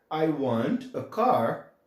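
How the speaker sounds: "I want a car" very slowly, word by word; slow model sentence. Shown as a demonstration. Teacher slowly models the English sentence for students. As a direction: drag out each word for learners. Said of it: "eu vou falar".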